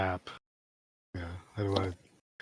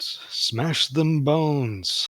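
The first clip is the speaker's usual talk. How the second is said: drawn-out rallying call, slightly raised; called-out battle cry; 5 semitones higher, 3 dB louder, much slower than usual. Acted. Player calls out in character to Jeff, drawn-out battle cry. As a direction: stretch it out like a hearty order to a comrade. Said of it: "And I'd call out".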